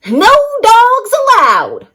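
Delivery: high, loud cactus yell. A narrator's reading. Hank yelling at the passing coyote. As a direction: bark the rule at him. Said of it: "Hank yelled".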